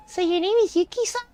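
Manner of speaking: put-on high-pitched curious child; high little kid, rising question. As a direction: ask like a curious nine-year-old. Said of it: "I'm reading in this kid voice".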